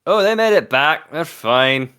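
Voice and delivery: proud Scottish father's news; Scottish Kagan. Acted. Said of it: "the best day to do a Scottish accent".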